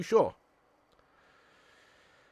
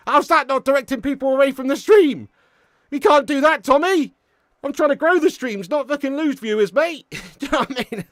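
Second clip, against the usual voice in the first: higher and louder, half-laughing complaint; exasperated telling-off; 9 semitones higher, 6 dB louder than usual. Real, not acted. Streamer scolding his mod over a link, loud amused exasperation. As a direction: rant at the mod, amused and fed up.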